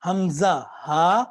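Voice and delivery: حمزہ ہا drawn out slowly; slow drill; slow throat letters. Shown as a demonstration. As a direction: repeat حمزہ ہا clearly. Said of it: "درمیان سے".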